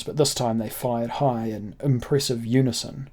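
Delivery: ordinary delivery, nothing marked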